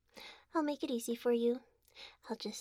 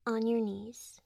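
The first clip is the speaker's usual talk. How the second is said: ordinary delivery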